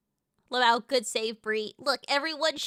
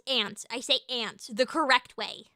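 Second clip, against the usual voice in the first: normal talking voice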